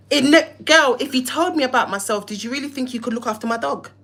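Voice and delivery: ordinary delivery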